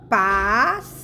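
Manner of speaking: "pássaro" pulled apart into long "pás", "sa", "ro"; syllable by syllable. Shown as a demonstration. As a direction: stretch each of the three syllables of "pássaro". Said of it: "eles se separam".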